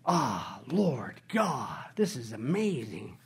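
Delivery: quiet, awed prayer; hushed awe. Acted. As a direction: breathe it out quietly before God. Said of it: "hushed closing prayer".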